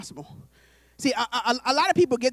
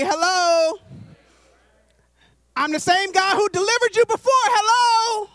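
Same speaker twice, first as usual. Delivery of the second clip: God calling out; 2 semitones higher than usual. Acted. God trying to get his people's attention, building calls. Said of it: "God is like".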